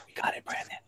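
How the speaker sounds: whispered aside, no voice; a hushed off-mic whisper. Real, not acted. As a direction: breathe it under the talk. Quietly aside to a streamer.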